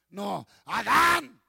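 mock scolding mother. Mother calling her child home, slow call. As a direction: drag out the name like a mother calling. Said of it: "Ahí viene el Señor Dios y dice".

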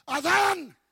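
high, loud call of Adam's name; God calling. Acted. God calling Adam in the garden, loud call. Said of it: "Ahí viene el Señor Dios y dice".

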